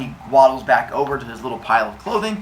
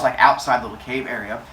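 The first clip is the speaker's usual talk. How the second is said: ordinary delivery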